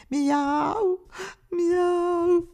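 high, drawn-out kitten wails; desperate meowing. A narrator's reading. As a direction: cry out like a lost kitten. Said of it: "à pleurer et à crier désespérément". (miaulements désespérés)

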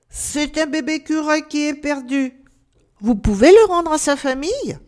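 high, loud call to someone above. A narrator's reading. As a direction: raise the voice to reach the treetop. Calling up to the treetop. (voix haute qui appelle d'en bas)

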